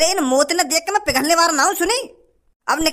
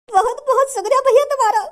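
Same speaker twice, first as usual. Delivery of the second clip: tearful thanks; 7 semitones higher than usual. Acted. Beggar thanks rescuer, first take.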